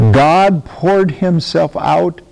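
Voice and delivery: loud, slow, each word pressed out; loud and slow on "poured himself out"; emphatic slow proclamation. Acted. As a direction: proclaim it like the punchline of the sermon. Climactic restatement after loud No.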